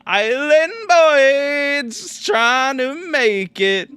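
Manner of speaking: high, slow mocking sing-song; drawn-out high meme voice; mock island boy sing-song. Streamer reading a chat message in a mocking high voice.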